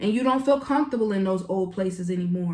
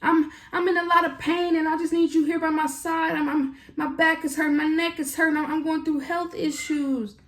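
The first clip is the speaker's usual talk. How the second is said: mock whiny complaint; 7 semitones higher than usual. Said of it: "telling you".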